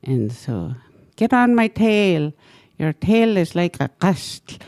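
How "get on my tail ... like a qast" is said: muskrat voice. Retold or imitated. Muskrat voiced in retold story.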